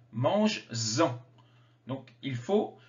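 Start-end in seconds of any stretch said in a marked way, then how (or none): 0.1-1.2 "Mange-en" drawn out, lower, with a clear z before "en"; liaison appuyée. Shown as a demonstration. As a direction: stretch "Mange-en" and lean on the linking z sound. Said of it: "j'ai fait la liaison". (« Mange-en » lentement, liaison en z bien marquée)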